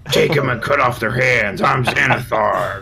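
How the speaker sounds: menacing crime boss threat; bad guy voice. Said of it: "a bad guy voice".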